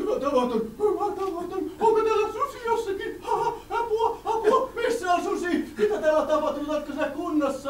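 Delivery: put-on very high, loud, laughing old village woman; flustered villager woman. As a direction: squeal in a panic like a fussy villager.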